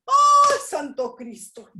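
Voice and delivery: a high, loud burst of shock on Ay; shocked cry. Retold or imitated. Telling the interviewer her shock, high loud exclamation. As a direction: gasp it out as if thunderstruck.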